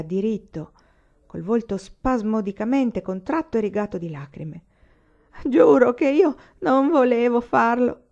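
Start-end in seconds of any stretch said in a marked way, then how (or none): 5.4-8.0 put-on high, loud, broken sob of a drunk man; drunken sobbing confession. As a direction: plead through tears, voice cracking. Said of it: "singhiozzò".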